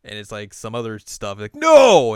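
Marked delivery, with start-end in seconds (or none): 1.6-2.2 exasperated mock shout, high and loud; angry retold no. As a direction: yell the refusal like a cartoon villain. Retold angry rejection, repeated.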